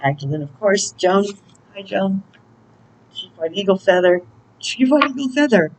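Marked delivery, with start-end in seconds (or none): 4.6-5.7 name said again in a raised, high-pitched voice; high-voiced second go at a name; playful high repeat.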